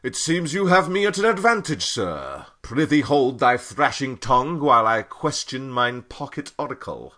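mock Shakespearean, high and fast; overacted archaic stage speech; hammy stage actor.

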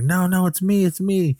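very high imitation of a panicked woman; acted-out desperate insistence; mock frantic pleading. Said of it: "he just snapped".

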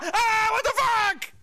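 mock horrified scream. Child on the boat sees the lobster, mock horrified cry. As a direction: shriek in fake terror.

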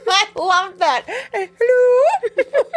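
can barely get the words out for laughing; helpless laughter. Real, not acted. Cracking up over a scene.

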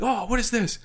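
high-pitched imitated questioning; curious guard impression. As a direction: mimic a guard puzzling over a find. Guard examining the bag, retold.

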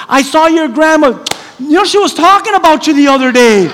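high, loud mock small talk; fake familiarity. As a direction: overdo the friendly chat to cover not knowing. Acts faking acquaintance in small talk.

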